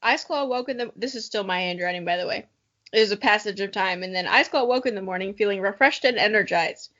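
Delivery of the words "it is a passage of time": ordinary talk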